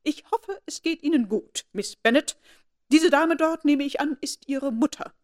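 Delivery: high, loud, stiffly formal grande dame; haughty aristocrat being icily polite; pointedly formal. A narrator's reading. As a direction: address her with cold, lofty formality. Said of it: "sagte sie betont förmlich".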